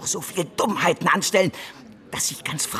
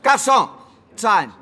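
calling out; 6 semitones higher, 6 dB louder than usual. Acted. Calling the waiter in restaurant.

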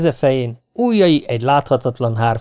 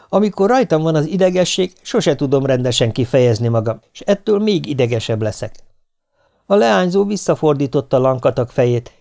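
plain reading voice

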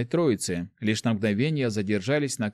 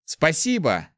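overjoyed; 6 semitones higher, slower than usual. A narrator's reading. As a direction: burst out with joy. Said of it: "обрадовался Март". (радостно)

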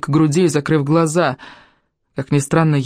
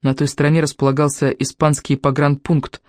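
ordinary delivery

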